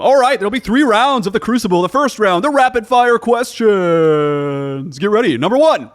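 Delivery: loud, high quiz host voice; game show announcer. Acted. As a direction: rattle off the rules like a quiz host.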